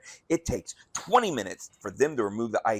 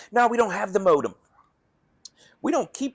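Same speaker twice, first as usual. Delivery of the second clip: high loud mock clerk; 11 semitones higher, 6 dB louder than usual. Voicing the clerk's refusal.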